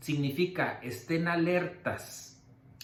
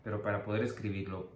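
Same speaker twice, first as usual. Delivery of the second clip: ordinary talk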